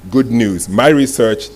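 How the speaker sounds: normal talking voice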